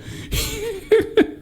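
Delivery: high squeaky laugh. Real, not acted. Laughing at own bit.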